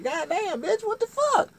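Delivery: high, loud exasperated cry; high-pitched acted outrage; outraged yell. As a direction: yelp back at her insults. Acted yell back at insults.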